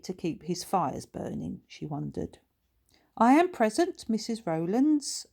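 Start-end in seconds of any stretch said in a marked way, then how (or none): 3.2-5.3 gracious. A narrator's reading. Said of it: "answered Billy graciously".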